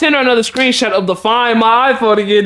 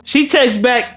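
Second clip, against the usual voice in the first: usual speaking voice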